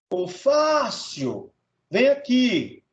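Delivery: "Fácil" called out with a long-held FÁ; "Fácil" drawn out slowly on FÁ, as a call; calling the word. Shown as a demonstration. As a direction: stretch the first syllable of "Fácil" like summoning someone. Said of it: "é você chamar a palavra".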